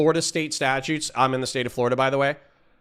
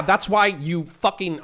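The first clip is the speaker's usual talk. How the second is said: normal talking voice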